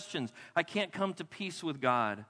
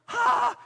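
high, loud shriek of shock; sudden acted scream, held on one note; mock horrified scream; 8 dB louder than usual. Guest looks in the bathroom mirror, mock horrified scream.

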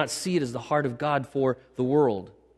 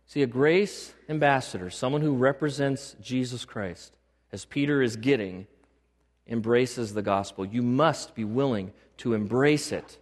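ordinary talk

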